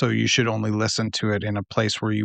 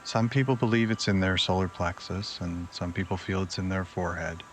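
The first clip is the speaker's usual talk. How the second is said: unhurried, lower and quieter, easing them into relaxation; guided meditation; 3 semitones lower, 7 dB quieter than usual. Acted. Host guides listeners to relax before sleep, guided meditation.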